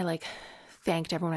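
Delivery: usual speaking voice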